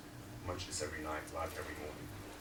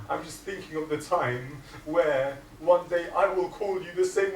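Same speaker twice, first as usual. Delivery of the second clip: louder and higher, crying out his name; grieving brother; 12 semitones higher, 13 dB louder than usual. Acted. Hussein to his brother Abbas, loud and grieving. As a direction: ache over the coming loss. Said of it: "Hussein says".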